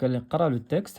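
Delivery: ordinary talk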